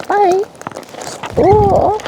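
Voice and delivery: very high delighted hi; greeting the birds in a tiny high voice; squeaky greeting. Real, not acted.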